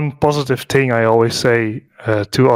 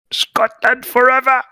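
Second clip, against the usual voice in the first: roaring dragon shout; 11 semitones higher than usual. Retold or imitated. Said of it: "Wasn't that Toothless Man video".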